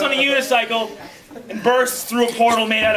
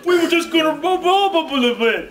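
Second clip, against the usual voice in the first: higher-pitched, babbling fake apology; mock apology; 6 semitones higher than usual. Attackers apologise to the man they clipped, mock apology. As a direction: plead sorry, then dissolve into blah-blah.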